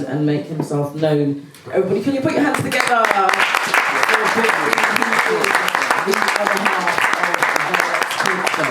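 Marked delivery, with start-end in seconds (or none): 1.7-8.7 louder, higher host hype; rally the room for applause; compère whipping up applause, louder and higher; calling out to audience. Real, not acted.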